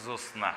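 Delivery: জ্যোৎস্না softly as josna, t dropped; everyday simplified pronunciation. Shown as a demonstration. Teacher showing how we say it, simplified pronunciation. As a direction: let জ্যোৎস্না fall softly as jos-na. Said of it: "আমরা উচ্চারণের সময় বলি".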